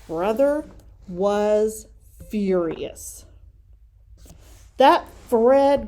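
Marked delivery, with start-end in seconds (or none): 4.8-5.9 loud angry growl, pitched up. Acted. Brother spotting Fred riding his bike, loud growl. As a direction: spit the name out in anger. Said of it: "growled Brother".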